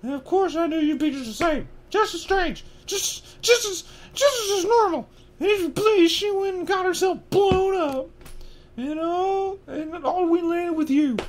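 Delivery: very high, strained falsetto tirade; ranting aunt. Acted. Aunt ranting about her sister, in a high screechy rant. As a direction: rant bitterly in a piercing high-pitched voice. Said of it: "then went ranting on".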